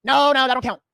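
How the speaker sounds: loud mock-dismissive official; mock denial. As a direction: brush it off like a sheriff's office dodging blame. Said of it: "But once you say".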